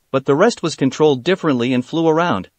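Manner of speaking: plain reading voice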